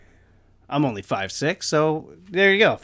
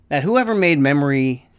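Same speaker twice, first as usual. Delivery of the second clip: normal talking voice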